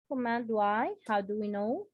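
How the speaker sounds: usual speaking voice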